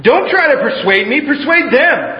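high, loud nervous outburst; blurted. Acted. Flustered proconsul blurting back at the prisoner, high and loud. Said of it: "blurted back".

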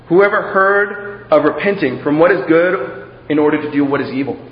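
normal talking voice

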